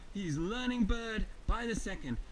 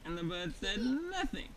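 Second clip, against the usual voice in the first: ordinary delivery, nothing marked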